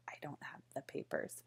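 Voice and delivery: hushed, whispered denial; scared whisper. Retold or imitated. Girl whispering to the stranger in the plaza. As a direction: whisper it as if too afraid to speak.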